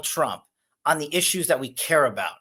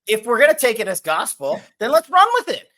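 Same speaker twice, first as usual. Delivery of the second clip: sarcastic excitement; 8 semitones higher, 6 dB louder than usual. Real, not acted. Mock-taking the smear as gospel.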